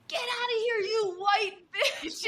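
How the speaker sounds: patient yelling at a nurse, hammed-up; mock shout. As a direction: hurl it like an angry patient. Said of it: "she goes".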